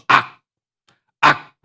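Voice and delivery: demonstrated yell. Shown as a demonstration. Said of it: "악을 쓴다고 말하죠".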